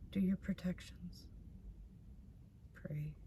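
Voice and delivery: breathy whispered advice. Real, not acted. Reader whispers advice to the viewer, softly. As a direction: hush it down to a whisper.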